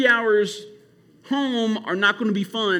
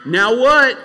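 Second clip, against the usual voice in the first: calling out to the crowd; 5 semitones higher, 10 dB louder than usual. Real, not acted. Asking the camp the question.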